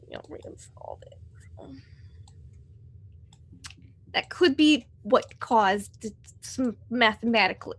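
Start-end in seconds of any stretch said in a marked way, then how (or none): 0.1-1.7 whispering. Pretend. Secretive aside.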